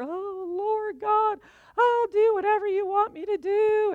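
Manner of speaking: high, loud, hammed-up tearful wail; mock blubbering. Praying at the altar as her younger self, mock blubbering. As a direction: blubber it out, stretching the opening words. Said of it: "I'm blubbering at the altar".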